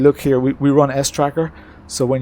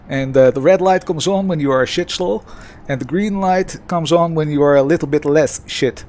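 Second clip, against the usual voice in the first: put-on Dutch accent. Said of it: "here we go in a bit of Dutch accent".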